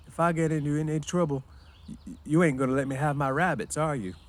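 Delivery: put-on high, simple childlike man; worried big simple man; Lenny voice. A narrator's reading.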